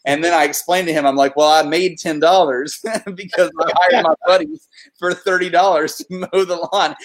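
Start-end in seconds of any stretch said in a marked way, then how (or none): 1.3-6.9 laughing through it. Retold or imitated. Teen self explains, laughing.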